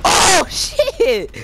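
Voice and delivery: high, loud startled yelp; startled shout. Real, not acted. Gamer reacting to a sudden attack, startled shout. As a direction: cry out in sudden alarm.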